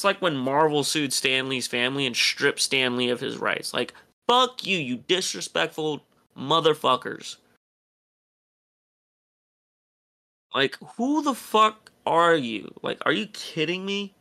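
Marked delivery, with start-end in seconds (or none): 3.7-14.1 ranting anger. Real, not acted. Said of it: "Now I'm fucking angry".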